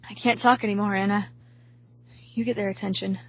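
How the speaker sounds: winded, spent voice; out of breath. A narrator's reading. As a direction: talk with barely any air left. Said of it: "run out of breath from crawling".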